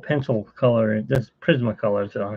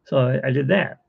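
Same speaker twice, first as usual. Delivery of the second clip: normal talking voice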